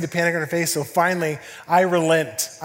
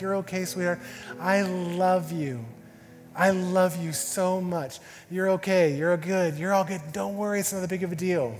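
higher, soothing parental voice; comforting father; 4 semitones higher than usual. Retold or imitated. Dad hugs his sobbing daughter, tender and reassuring. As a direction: reassure a crying child tenderly.

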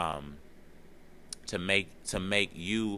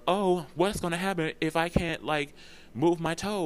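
anxious fretting; 10 semitones higher than usual. Retold or imitated.

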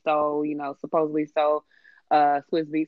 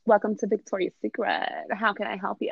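a light mock of a chirpy shop clerk; imitating sing-song salesgirl; 3 semitones higher than usual. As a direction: lightly voice the cashier's greeting.

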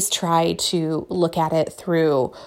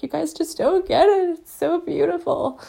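higher, gushing and tearful in jest; a playful take on herself sniffling over beauty; mock gushing; 10 semitones higher than usual. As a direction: play herself overcome with emotion, higher. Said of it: "be like".

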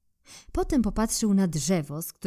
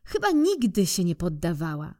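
ordinary delivery

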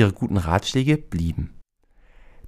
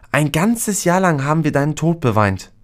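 upset calling out; 7 semitones higher, 3 dB louder than usual. A narrator's reading. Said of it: "rief sie".

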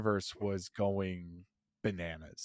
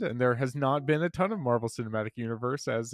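usual speaking voice

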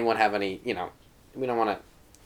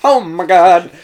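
loud awe; 4 semitones higher, 8 dB louder, much slower than usual. Acted. Echoed awe at the movie.

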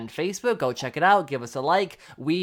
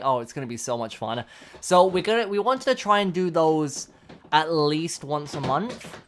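ordinary talk

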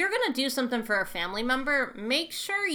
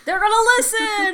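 laughing; 11 semitones higher, 10 dB louder than usual. Real, not acted. Laughing at friend's worry.

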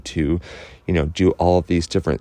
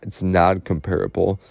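ordinary delivery

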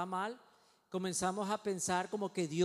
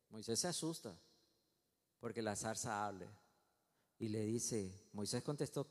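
ordinary delivery

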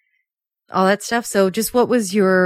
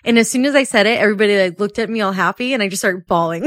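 usual speaking voice

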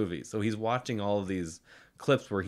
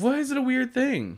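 indignant squeak; 14 semitones higher than usual. Real, not acted. Indignant at partner.

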